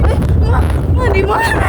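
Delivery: high, loud call for mom; calling out over wind. Real, not acted. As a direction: call out to find her.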